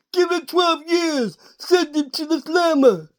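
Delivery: slow, high thick-tongued judge voice; mock judge. Recapper voicing the wrestler's judge gimmick, slow thick-tongued. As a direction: play a judge with a fat tongue. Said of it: "all the judges have got fat tongues".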